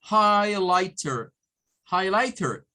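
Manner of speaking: highlighter said slowly, hai-lai-ter with a clear r; American highlighter. Shown as a demonstration. Teacher modelling American highlighter slowly and loud. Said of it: "lo vamos a pronunciar como highlighter".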